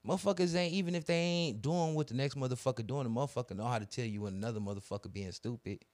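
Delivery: ordinary delivery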